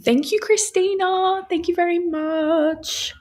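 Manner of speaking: high, delighted thank you; gushing thanks to a viewer in chat; excited thanks. Real, not acted. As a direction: beam your thanks upward.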